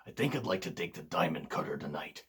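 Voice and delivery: low, whispered wrestler imitation; Randy Savage impression. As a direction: hush it low and soft like a tired wrestling legend doing a promo. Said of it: "I can't do a great Randy Savage impression".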